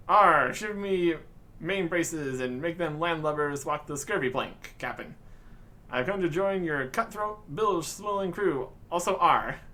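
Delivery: theatrical pirate bluster; hammed-up pirate. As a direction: ham up a pirate recruit, arr and all. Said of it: "Are you quite done, lad?".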